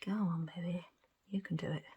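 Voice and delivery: soft whispered encouragement to the bubbles; coaxing whisper. Real, not acted. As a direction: urge them on under your breath.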